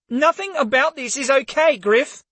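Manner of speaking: high, sharp angry retort. Acted. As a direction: bite off each word in anger. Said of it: "Her words were sharp and angry".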